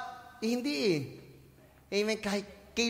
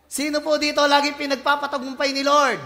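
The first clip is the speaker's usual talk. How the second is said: ordinary talk, nothing marked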